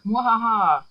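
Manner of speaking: loud evil villain laugh; mad scientist cackle. Acted. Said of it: "It's like".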